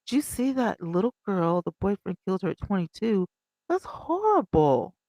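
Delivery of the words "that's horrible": high, slow horrified gasp; shocked dismay. Real, not acted. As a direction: let the horror stretch out. Reacting to murdered girl story.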